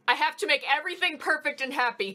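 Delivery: fast, loud and eager; eager to please. Retold or imitated. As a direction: rush to fix everything. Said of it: "automatically be like".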